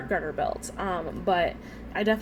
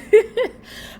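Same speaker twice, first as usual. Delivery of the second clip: burst of laughter; 13 semitones higher, 9 dB louder than usual. Real, not acted. Laughs at own stumbling.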